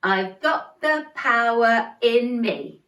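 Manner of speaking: slow, got and power punched; stress on got and power. Shown as a demonstration. Teacher leading the chorus, slow stressed got and power. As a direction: lean on got and power slowly. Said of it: "They're where the emphasis comes".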